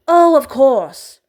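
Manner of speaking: flustered and forced, slow and loud; stammering. A narrator's reading. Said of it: "Andromeda stammers".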